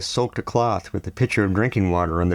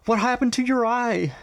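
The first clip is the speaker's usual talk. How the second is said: high, startled mother's cry; gasping shock. A narrator's reading. Nadia to her bruised son, gasping in alarm. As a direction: catch your breath in alarm at his face. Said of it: "with a gasp".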